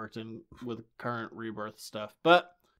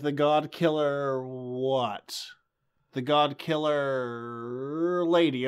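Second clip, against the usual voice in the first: slow emphasis; much slower than usual. Retold or imitated. Mocking the film's hint.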